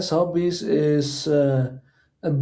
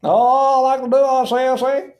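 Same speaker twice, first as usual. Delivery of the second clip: put-on a higher-pitched old-fashioned toff voice; posh British; 7 semitones higher, 4 dB louder than usual. Joking with a gaming friend, mock posh English gentleman. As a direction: say it like a plummy gentleman, "I say".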